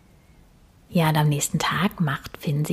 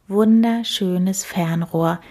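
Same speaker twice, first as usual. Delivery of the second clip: usual speaking voice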